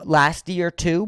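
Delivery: ordinary talk, nothing marked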